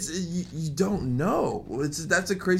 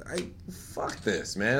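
slow muttered; 6 semitones lower, 7 dB quieter, slower than usual. Retold or imitated. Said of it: "I would have been just like".

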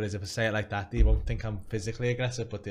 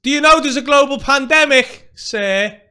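much higher and louder, a posh commanding yell; posh authoritative shout; 18 dB louder than usual. Shown as a demonstration. Shouting at a coughing stranger, posh and authoritative. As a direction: bellow it like a posh official. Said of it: "Go ahead, you shout it".

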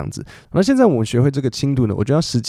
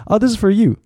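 higher-pitched "this is for you"; example giver; 10 semitones higher than usual. Shown as a demonstration. Teacher plays someone giving a gift, high example voice. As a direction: act out handing over a gift, high voice. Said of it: "比如说".

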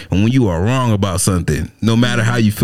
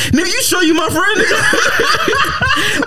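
squeaky, much higher protest to a friend; high mock disbelief; much slower than usual. Artist asking his harsh critic friend, high mock disbelief. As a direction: pitch way up in comic hurt. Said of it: "I used to be like".

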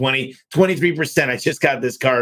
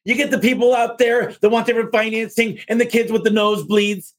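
much higher, playful impression of a keynote speaker; mimicking a stage speaker; 10 semitones higher than usual. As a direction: put on a bright showman voice to mock the crowd. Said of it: "He's like".

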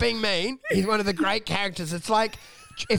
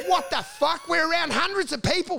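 much higher, incredulous exasperation; retold outrage; 10 semitones higher than usual. Retelling snapping at the comic about the crowd, high and exasperated. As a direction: blow up in disbelief. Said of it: "I'm like".

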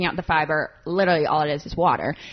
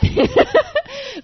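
short laugh; 6 dB louder than usual. Real, not acted. Laughs mid-sentence.

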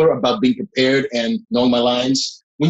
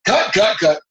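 higher-pitched imitation of a set call; mock calling out; 7 semitones higher, slower than usual. Film crew halting a take in the retold scene, mock calling out. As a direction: call a halt, as if retelling it.

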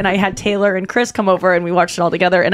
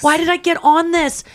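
scared whine; 8 semitones higher than usual. Retold or imitated. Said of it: "being like".

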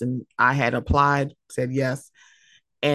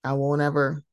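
ordinary talk, nothing marked

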